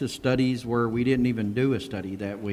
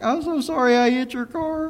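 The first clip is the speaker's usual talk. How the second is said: imitating a sobbing teenage girl, much higher; bawling daughter; 13 semitones higher, 3 dB louder than usual. Retelling the crying daughter apologizing to the pastor, high mock-tearful voice.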